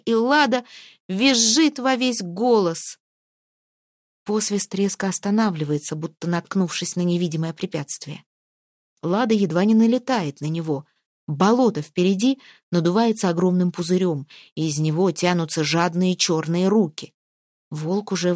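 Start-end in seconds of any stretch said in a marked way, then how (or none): none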